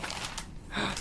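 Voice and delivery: soft sharp gasp; stunned gasp. Real, not acted. Reader reaches the book's last joke, stunned gasp. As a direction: catch the breath in disbelief.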